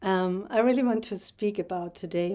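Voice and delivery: ordinary talk, nothing marked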